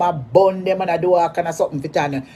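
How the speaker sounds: ordinary talk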